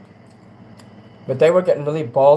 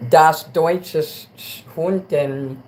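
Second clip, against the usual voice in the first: slow, mock German accent; 2 semitones higher, much slower than usual. Friend jokingly sings her German Shepherd in German accent. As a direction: drawl the words in a hammed-up German accent. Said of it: "You singing it with the German accent".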